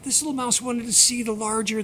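ordinary delivery, nothing marked

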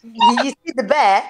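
high mock-British voice; British accent. Friend repeating the British neighbor's question, mock British accent. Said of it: "y'all was just doing that accent".

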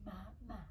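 "Mama" said softly in two parted syllables; teaching a word. Shown as a demonstration. Adult teaching baby to talk, "Mama" soft and parted. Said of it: "Can you say mama?".

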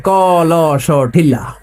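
"ক ল স" slow and drawn out, then "ঠিল্লা"; mock spelling lesson. Preacher mocks Chattogram teaching, "ক ল স" stretched, then "ঠিল্লা". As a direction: stretch each letter of "ক ল স", land on "ঠিল্লা". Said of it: "এরা উচ্চারণ করে".

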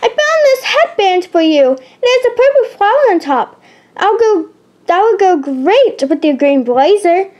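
put-on high-pitched, eager girl character; chirpy friend voice. A narrator's reading. Shakira offers Emma a headband, high eager voice.

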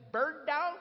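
high, loud Southern query, rising at the end; puzzled Southern boss. Retold or imitated. Sam questioning a visitor's odd name, puzzled and Southern. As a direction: echo the odd name in disbelief. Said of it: "this really strange look on his face".

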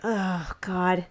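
low-energy exasperated aside; mock exasperation. Friends react to toast to Lily, mock exasperation. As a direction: groan it under your breath.

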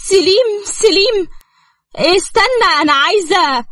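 high-pitched anxious calling; urgent pleading. Acted. Wife stops her husband mid-quarrel, urgently pleading.

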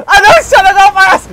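fast, high, loud protest; frantic yell. Real, not acted. Player being chased by a friend, frantic yell. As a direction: yell it in a rush.

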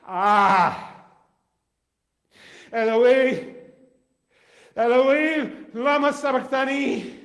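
anguished cry, loud and high; dying man crying out to God in anguish. Acted. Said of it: "cried out in a loud voice".

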